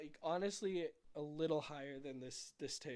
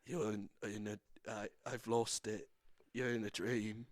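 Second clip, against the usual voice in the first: put-on Michael Caine accent; 5 semitones lower than usual. Quoting Inception as Michael Caine, lower mock British accent. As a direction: drop low into a half-serious British film voice. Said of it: "this is Michael Caine".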